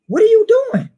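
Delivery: high, loud scolding shout; scolding parent. Retold or imitated. Parents scolding their son for staying behind, loud scolding. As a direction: scold the child sharply. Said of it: "They scolded him".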